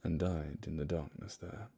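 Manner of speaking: hushed storyteller reading a ballad; poem recital. Acted. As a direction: read it like a haunting ballad. Reads The Highwayman poem aloud.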